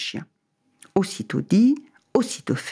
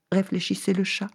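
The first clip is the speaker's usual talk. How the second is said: ordinary delivery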